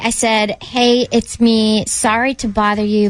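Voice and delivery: ordinary talk, nothing marked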